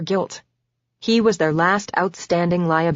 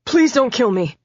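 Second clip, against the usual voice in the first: higher, frightened begging; pleading; 7 semitones higher, 4 dB louder than usual. A narrator's reading. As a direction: beg for your life. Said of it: "Damon pleaded".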